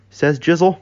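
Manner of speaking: plain reading voice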